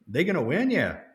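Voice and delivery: high rise on "They gonna win?" then a falling "Yeah."; Cajun tag question. Shown as a demonstration. Showing the Cajun "Yeah" at the end, light Cajun demo. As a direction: ask it high, answer yourself with a flat "Yeah.". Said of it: "where we'll say, "Yeah," at the end".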